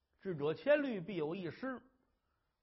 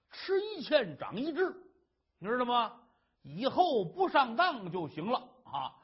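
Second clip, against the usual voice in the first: higher, louder, laughing husband; breezy husband; 6 semitones higher, 4 dB louder than usual. Acted. Husband brushing off his wife's trouble, light and laughing. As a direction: laugh it off and reassure.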